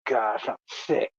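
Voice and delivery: low, hushed, sickened mutter; mock queasy aside. Coworker watching the lovey scene, low mock-sick mutter. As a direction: drop low and soft, feigning nausea. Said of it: "I said".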